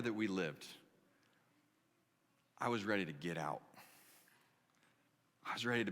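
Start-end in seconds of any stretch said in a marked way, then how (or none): none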